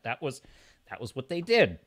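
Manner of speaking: ordinary delivery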